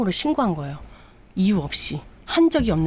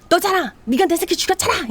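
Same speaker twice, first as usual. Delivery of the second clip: much higher, louder screaming accusation; furious accusing shout; 11 semitones higher, 4 dB louder than usual. Retold or imitated. Mother screaming at her daughter-in-law for killing her son. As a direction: hurl the blame at her.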